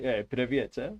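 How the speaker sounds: casual Russian-accented greeting; light put-on Russian accent. Shown as a demonstration. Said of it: "like, Russian words and stuff it comes across".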